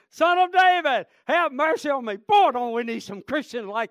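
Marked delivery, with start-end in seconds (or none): 0.1-2.2 a loud pleading cry, higher and louder. Acted. Blind beggar crying out to Jesus, loud pleading cry. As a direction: cry out to be heard over the crowd. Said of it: "he cried the more a great deal".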